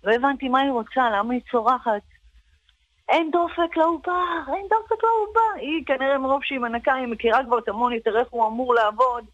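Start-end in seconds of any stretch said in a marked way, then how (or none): 3.1-4.4 high mock scream of alarm; a cleaner's retold panicked shout. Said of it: "היא התחילה לצרוח". 4.5-5.6 much higher shrieking repeat; retold shriek. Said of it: "היא התחילה לצרוח".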